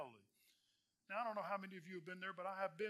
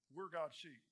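ordinary delivery

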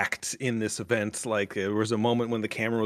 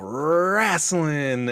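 drawn-out, higher-pitched mock yell; retold crowd yell; 5 semitones higher than usual. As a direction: call it out long, like a fan imitating the chant. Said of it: "someone will just yell".

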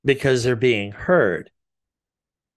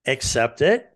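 normal talking voice

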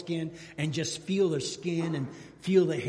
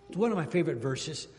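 normal talking voice

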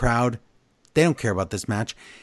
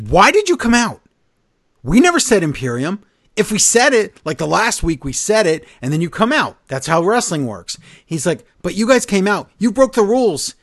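higher, louder frantic rant building up; freaking out; 7 semitones higher, 6 dB louder than usual. Retold or imitated. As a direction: imitate a wrestler losing his mind. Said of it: "he's freaking out".

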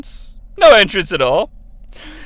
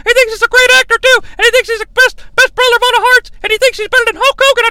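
very high, loud, fast squawking manager; mock Jimmy Hart; 3 dB louder, much faster than usual. Jimmy Hart gossiping to Fuji, very high and fast. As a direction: rattle it off like a hyper wrestling manager. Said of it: "fly on the wall to hear".

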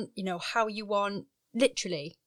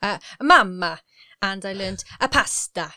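louder imitated Italian accent; 5 dB louder than usual. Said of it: "Italian friends".

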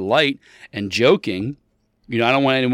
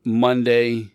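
ordinary talk, nothing marked

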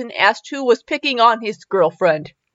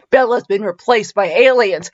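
normal talking voice

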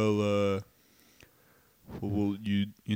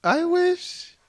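squeaky son character; 3 dB louder than usual. Acted. Son announcing his wished-for parent, squeaky falsetto. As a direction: squeal the wish like a comic child.

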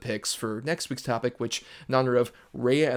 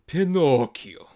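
Pinocchio stretched out loud and slow; big, slow title reveal; drawn-out reveal; 4 semitones higher, 6 dB louder, much slower than usual. Real, not acted. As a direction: announce the title with relish. Grand reveal of the pick.

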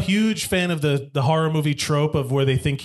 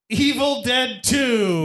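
announcer reveal; 6 semitones higher, much slower than usual. Acted. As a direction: draw out the name like a host. Announcing the matchup, game-show style.

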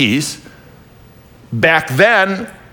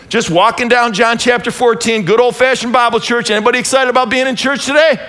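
fiery preaching; 8 semitones higher than usual. Acted. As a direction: preach it out to the whole church, full force. Preaching at full force.

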